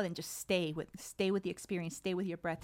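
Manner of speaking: ordinary talk, nothing marked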